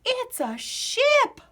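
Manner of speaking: very high, slow, delighted shout; shouted with joy. A narrator's reading. Wavy seeing the toy ship, high thrilled shout. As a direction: shout it with glee. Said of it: "Wavy shouted".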